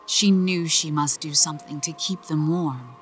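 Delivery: ordinary delivery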